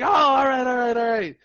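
high, loud rapid-fire acceptance; worked-up, tumbling repeated agreement; acted excitement. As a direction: reenact a thrilled yes. Acts out excited acceptance of win.

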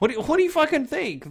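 high, loud, fast comeback; exasperated retort. Acted. Man answering girl's worry about parents, exasperated retort. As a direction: fire it back in comic disbelief.

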